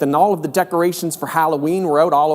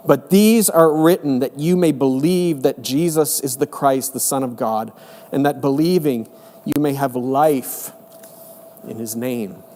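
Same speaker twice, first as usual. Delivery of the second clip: scripture reading. Acted.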